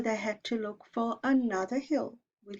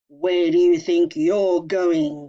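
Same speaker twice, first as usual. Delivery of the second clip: slow, menacing troll growl, louder; 2 semitones lower, 10 dB louder, slower than usual. Acted. Troll menacing the little goat, slow and loud. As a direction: loom over the little goat, slow and threatening.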